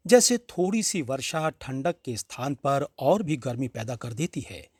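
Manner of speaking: ordinary delivery, nothing marked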